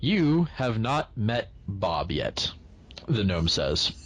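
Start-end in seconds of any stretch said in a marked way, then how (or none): 0.0-2.5 slow ominous hint. Acted. Gnome hints ominously.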